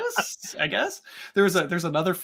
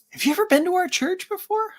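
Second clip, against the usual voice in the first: high curious question; 4 dB louder than usual. Retold or imitated. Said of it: "he's like".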